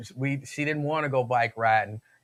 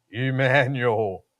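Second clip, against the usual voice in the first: deep, slow, shaky voice of God; 3 semitones lower, much slower than usual. Acted. God calling the speaker by name, deep shaky voice. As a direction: draw it out slowly, deep and quavering. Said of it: "he got that shaky voice and it's deep".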